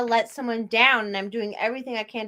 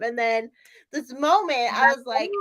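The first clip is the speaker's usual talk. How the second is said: ordinary talk, nothing marked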